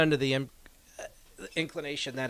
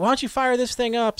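put-on high, eager kids' plea; eager kids; 10 semitones higher, 4 dB louder than usual. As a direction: ask like an excited child. Kids begging teacher.

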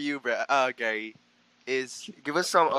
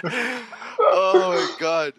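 laughing through the words, slow; cracking up mid-sentence; 6 semitones higher, much slower than usual. Real, not acted. Cracks up at Gary's answer.

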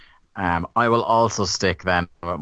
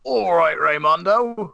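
put-on Cockney accent; 10 semitones higher, 3 dB louder, slower than usual. Co-host joins the Cockney bit.

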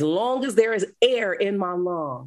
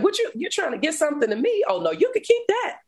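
incredulous brush-off, voice climbing much higher; mock disbelief; 10 semitones higher, faster than usual. Recalling her reply to God in the cell, incredulous and high. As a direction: turn it down like the offer is absurd. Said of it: "And I'm like".

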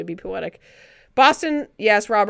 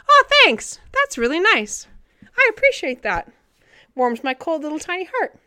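delighted thanks; 7 semitones higher, 4 dB louder than usual. Real, not acted. Thanking a kind chat comment.

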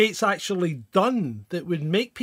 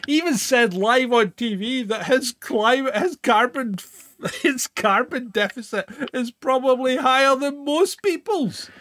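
laughing indignation; 6 semitones higher, 4 dB louder than usual. Real, not acted.

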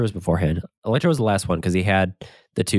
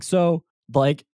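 high, baffled question; curious disbelief; 8 semitones higher, slower than usual. Retold or imitated.

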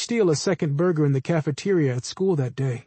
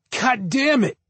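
screamed curse; 7 semitones higher than usual. Acted. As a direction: cry it out as he falls hurt. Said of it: "he screamed".

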